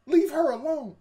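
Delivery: high, loud retold order; mock heroic command. Main character on the boat defending the girl, mock commanding. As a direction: call out a hammed-up rescue line. Voices hero confronting gang.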